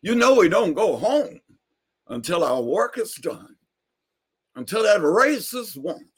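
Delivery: high preaching cadence; preaching old man. Retold or imitated. Neighbor preaches to Carlos, high sermon voice. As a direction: quote scripture like a sermon.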